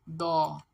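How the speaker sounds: दो loud and long as open "daw"; syllable sound demo. Shown as a demonstration. Teacher answers what the letters make, drawn-out दो. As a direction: stretch दो firmly, set apart. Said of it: "दिगत और ओ क्या बनेगा?".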